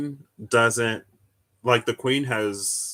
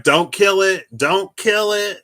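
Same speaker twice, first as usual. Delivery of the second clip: high, loud pleading yell; imitated scream; 10 semitones higher, 9 dB louder than usual. Teacher shouting to the class to spare the bee. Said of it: "the teacher is screaming".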